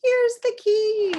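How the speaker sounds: high, loud and slow call; the grown-up calling to the boy who forgot his keys. Acted. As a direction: call after the running boy. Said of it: "his grown-up is chasing him, saying".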